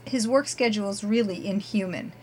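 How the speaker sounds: ordinary talk, nothing marked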